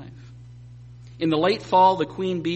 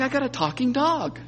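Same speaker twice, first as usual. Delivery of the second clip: dazed amazement; 8 semitones higher than usual. Pretend. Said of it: "You're gonna be sitting there going".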